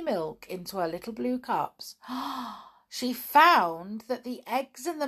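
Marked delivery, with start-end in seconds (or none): 2.0-2.6 sharp dismayed intake of breath; storyteller's gasp of dismay; startled gasp. A narrator's reading. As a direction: gasp as the trouble dawns. Party supplies missing, dismay.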